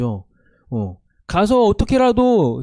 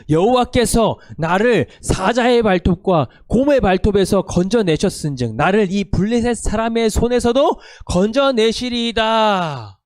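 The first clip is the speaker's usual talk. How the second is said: scripture reading; 4 semitones higher, 4 dB louder than usual. Acted. Leads congregation reading scripture.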